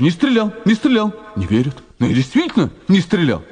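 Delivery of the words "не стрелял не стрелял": high desperate cry of innocence; retold shout. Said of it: "Я кричу". (отчаянный крик)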